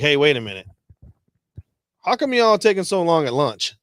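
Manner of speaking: suspicious boss. Retold or imitated. Teller voicing the commander asking about long lunches, loud and suspicious. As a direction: play the boss catching them out.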